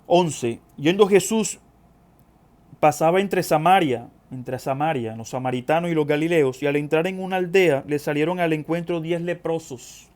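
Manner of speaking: declamatory scripture reading, punching key phrases. Acted. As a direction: proclaim the passage to the congregation. Reads scripture aloud to congregation.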